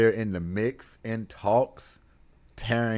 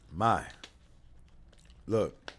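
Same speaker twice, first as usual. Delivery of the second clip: ordinary talk, nothing marked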